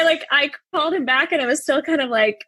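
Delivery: ordinary delivery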